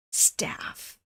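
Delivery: whispered in disbelief; appalled whisper. Real, not acted. Reacting to a worker getting slapped, appalled whisper. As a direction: drop to a stunned whisper.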